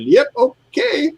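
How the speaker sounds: very high, drawn-out squeak; retold squeaky agreement. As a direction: slowly squeak out your innocent consent. Retelling his gleeful reply at register.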